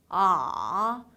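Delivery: 아 dropping low, then lifting slightly; third tone. Shown as a demonstration. Teacher showing third tone, low dipping 아. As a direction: push 아 down low with a small bounce up. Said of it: "내려가는 느낌만 좀 강하게".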